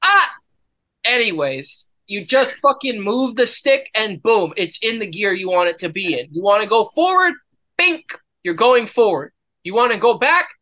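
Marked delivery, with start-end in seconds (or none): 0.0-0.3 high loud yelp; sharp yelp. Real, not acted. Sudden yelp. 7.8-8.1 high bright bink; sound effect. Pretend. Gear-shift sound effect.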